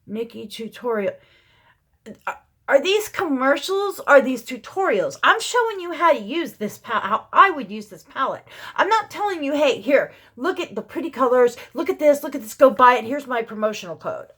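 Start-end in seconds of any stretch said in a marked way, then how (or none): 2.3-9.5 high, loud exasperated rant; worked-up venting. Real, not acted. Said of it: "I had to vent". 9.5-14.3 loud, mocking promoter's patter; mock sales pitch. Mimicking tutorials as sales pitches.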